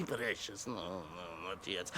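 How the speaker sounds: ordinary delivery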